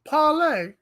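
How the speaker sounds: "Parlay" echoed with no r, pah-lay; playful accented parlay. Pretend. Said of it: "That's better than the German accent".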